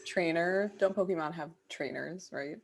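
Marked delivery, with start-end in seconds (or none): none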